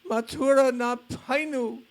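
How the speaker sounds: high, loud cry of longing; anguished cry. Retold or imitated. Madhavendra Puri crying out for Krishna, a high anguished cry. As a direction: cry it out like a heartbroken devotee. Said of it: "He was crying out".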